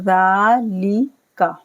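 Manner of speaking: ज़ालिका with ज़ा drawn out; slow recitation; long za. Shown as a demonstration. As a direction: stretch ज़ा, keep लिका short. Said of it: "जाल को खींचना है".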